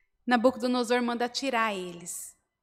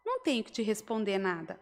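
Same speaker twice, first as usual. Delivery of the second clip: softer, matter-of-fact reply; short and to the point; 8 dB quieter, faster than usual. Retold or imitated. Young men answering the king, short and objective. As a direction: answer briefly, without any show. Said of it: "Eles responderam bem curto e objetivo".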